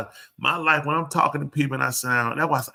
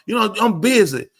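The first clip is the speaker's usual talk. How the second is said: higher, sharp irritated brush-off; 7 semitones higher, 6 dB louder than usual. Retold or imitated. Wife brushing off husband who wants to talk, irritated. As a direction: snap at him to leave you alone.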